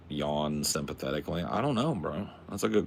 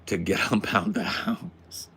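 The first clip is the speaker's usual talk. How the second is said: laughing; 3 semitones higher, slower than usual. Real, not acted. Laughs mid-line.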